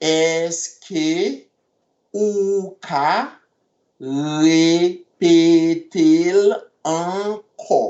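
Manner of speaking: Èske ou ka repete l ankò in slow separate chunks. Shown as a demonstration. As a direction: pause between each piece.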